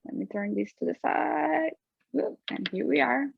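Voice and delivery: normal talking voice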